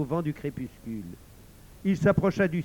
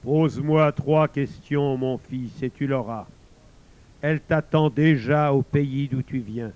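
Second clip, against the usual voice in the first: voice of God; 7 dB louder than usual. Acted. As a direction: pronounce it like the almighty. God answers traveler.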